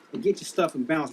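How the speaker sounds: usual speaking voice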